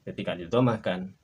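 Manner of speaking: ordinary talk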